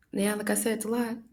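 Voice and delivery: normal talking voice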